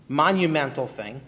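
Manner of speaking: ordinary talk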